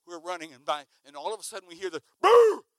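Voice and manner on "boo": loud, high mock bark; imitated dog bark. Preacher imitating the dog behind him, loud high bark. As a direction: bark like a big chasing dog.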